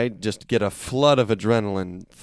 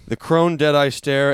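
ordinary talk

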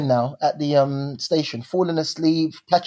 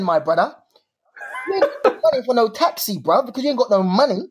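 higher-pitched, insistent talking-to; self-scolding; 6 semitones higher than usual. Retold or imitated. Man stranded at night telling himself off, self-scolding. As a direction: scold yourself in a raised pitch. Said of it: "Then I start saying to myself".

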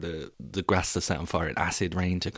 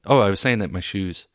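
ordinary talk, nothing marked

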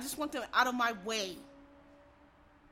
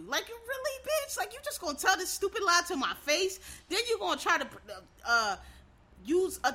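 incredulous outrage; 7 semitones higher, 4 dB louder than usual. Acted. Confronting his brother's killer.

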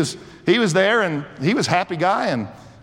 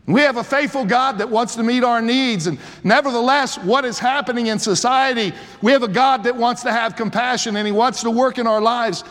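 higher, lifted preaching voice; preacher driving the point home; fervent preaching; 7 semitones higher than usual. Acted. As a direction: build the sermon with rising fervor. Preaching at full force.

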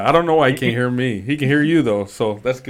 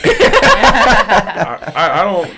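laughing; 6 semitones higher, 7 dB louder, slower than usual. Real, not acted. Laughing at her own joke.

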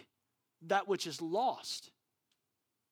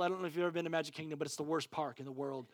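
ordinary talk, nothing marked

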